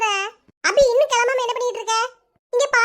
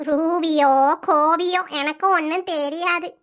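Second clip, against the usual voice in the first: put-on slow, lower, puzzled old granny on the phone; old grandmother; 6 semitones lower, slower than usual. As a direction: drag each word like a confused grandma. Grandmother grumbling.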